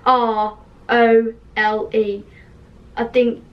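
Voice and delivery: ordinary talk, nothing marked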